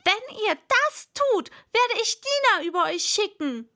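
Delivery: high, forceful threatening yell; loud shout. Acted. Alice threatening those outside the house, loud high shout. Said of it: "schrie Alice so laut sie konnte".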